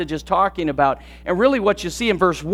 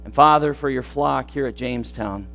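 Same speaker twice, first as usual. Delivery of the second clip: soft, low prayer voice; hushed closing prayer over music; 4 semitones lower, 4 dB quieter than usual. Acted. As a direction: pray it quietly and humbly.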